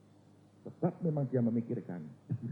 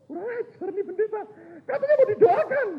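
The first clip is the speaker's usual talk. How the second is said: offended outrage; 9 dB louder than usual. Retold or imitated. Offended congregants ranting.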